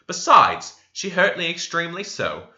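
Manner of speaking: usual speaking voice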